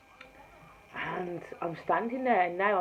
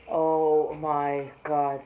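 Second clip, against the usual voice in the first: awed exclamation; 4 semitones lower, 5 dB louder, much slower than usual. Real, not acted. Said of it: "Sorry to call his name in vain".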